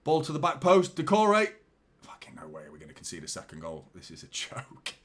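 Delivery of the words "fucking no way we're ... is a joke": muttered frustration. Real, not acted. Quiet fury at conceding.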